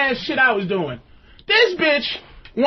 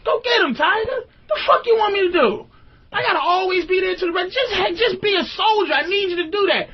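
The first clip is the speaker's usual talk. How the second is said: mock pep talk; 5 semitones higher than usual. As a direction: cheer her on like a coach, half mocking. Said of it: "I'd be like".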